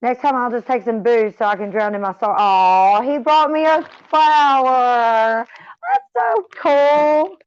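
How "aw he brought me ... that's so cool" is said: higher, slow and louder with joy; real delight at a gift; touched delight. Real, not acted. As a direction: gush over the flower, slow and bright. Gets flowers on stream.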